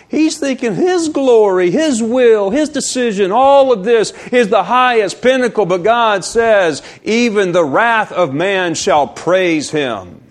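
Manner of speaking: high, driving pulpit delivery; forceful preaching. Real, not acted. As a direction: hammer out the list like a preacher.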